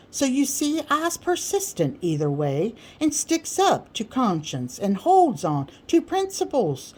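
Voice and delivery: expound grandly, very pleased with yourself; self-important Sam. Acted. Sam explains his conscience.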